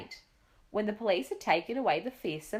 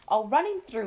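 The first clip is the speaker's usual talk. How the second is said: louder fierce threat; shouting; 4 semitones higher, 6 dB louder than usual. A narrator's reading. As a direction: Duke waving his sword at the burglar. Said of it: "he shouted".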